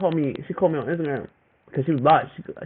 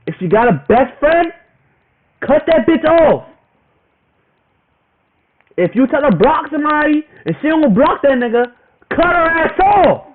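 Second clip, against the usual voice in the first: heated warning; 9 semitones higher, 5 dB louder than usual. Real, not acted. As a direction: hammer the advice home, louder each line. Ranting warning to other guys.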